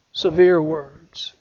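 ordinary talk, nothing marked

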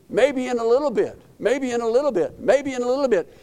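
high, repeated stalling refrain; mock procrastinating. Voices his own repeated putting-off.

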